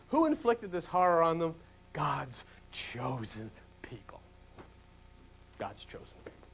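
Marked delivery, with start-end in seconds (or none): 1.9-4.2 quiet, slow. Acted. Repeated for effect, softer. 5.6-6.5 hushed whisper, under the breath. Acted. Repeated as whisper.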